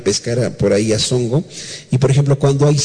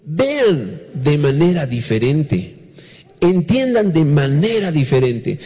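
slow emphasis; slower than usual. Real, not acted. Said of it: "sino que dice".

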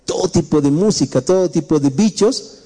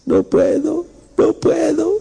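high, mock-sobbing no puedo rising at the end; crying youth; 12 semitones higher, slower than usual. A preacher voices a youth crying at his family at 2 a.m., in a high mock sob. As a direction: imitate a boy weeping at night.

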